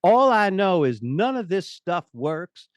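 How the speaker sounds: put-on in Southern accent, demand plain English, slowing on the first line; Southern accent, fed up. The unhappy Southern director scolds the sales manager, in a loud mock Southern accent. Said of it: "he had this Southern accent".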